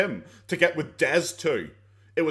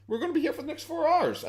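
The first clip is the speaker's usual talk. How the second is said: much higher mock whine; 12 semitones higher than usual. Real, not acted.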